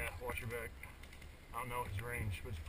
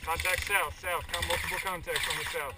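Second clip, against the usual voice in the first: callout shout; 3 semitones higher, 6 dB louder than usual. Real, not acted. Relaying enemy contact.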